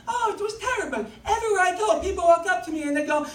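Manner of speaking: high-pitched complaining girlfriend impression; Sabina. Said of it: "I don't remember what Sabina's voice sounded like".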